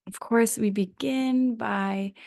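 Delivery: ordinary talk, nothing marked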